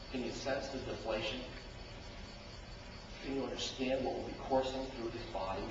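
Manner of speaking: low, soft probing questions; preacher drawing listeners in; intimate. Real, not acted. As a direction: lean in and murmur to the congregation.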